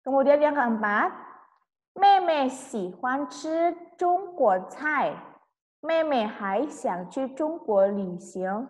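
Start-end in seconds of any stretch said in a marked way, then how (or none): 1.5-8.7 very slow, syllable by syllable; slow model reading. Shown as a demonstration. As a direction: dictate it slowly to the class. Teacher reads new sentences. (membaca sangat pelan)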